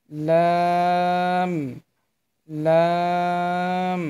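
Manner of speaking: লাম drawn out long; teacher modelling লাম slowly; slow letter demo. Shown as a demonstration. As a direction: stretch লাম slowly for learners. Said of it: "এখানে".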